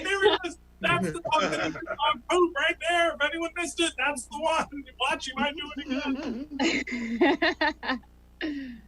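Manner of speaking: put-on high, insistent little-kid voice; imitated four-year-old narc. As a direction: point it out to everyone like a small child. Daughter pointing out the dog.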